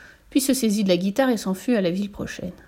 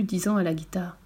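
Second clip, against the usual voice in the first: plain reading voice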